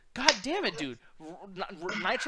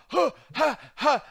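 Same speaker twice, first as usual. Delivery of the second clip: imitating a cat coughing up a fur ball; mock hairball cough; 5 semitones higher, 4 dB louder, slower than usual. Mocking a wrestler's hairball noises, imitated cat cough. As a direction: cough it up like a cat, a little higher. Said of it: "I thought".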